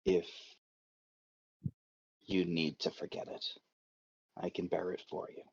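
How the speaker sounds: soft, hushed voice with long pauses; very quiet. Acted. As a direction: say it very quietly, leaving space between phrases. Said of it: "says very quietly".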